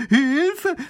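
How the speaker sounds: panicked cry. A narrator's reading. Neighbour panics at dragon news.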